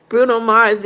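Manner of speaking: laughing. Real, not acted. Laughing at viewer comment.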